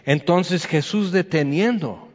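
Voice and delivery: normal talking voice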